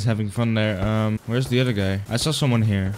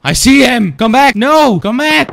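shouting after him; 11 semitones higher, 9 dB louder than usual. Real, not acted. Chasing another player.

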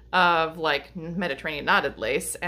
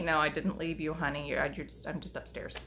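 soothing the dog; 3 semitones lower, 8 dB quieter than usual. Real, not acted.

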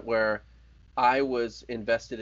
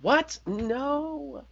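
high outraged disbelief; shocked squeak of protest; surprised. Real, not acted. Reacting to Simpsons votes.

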